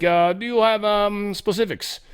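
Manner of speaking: high, slow mock Russian accent. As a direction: question him in a put-on Russian accent. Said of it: "Putin has a Russian accent".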